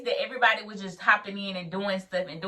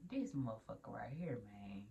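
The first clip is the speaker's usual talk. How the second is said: low, very soft retold grumble; muted imitation of private annoyance; inner thought; 7 semitones lower, 16 dB quieter than usual. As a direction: mumble it to yourself, fed up. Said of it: "she was in her mind".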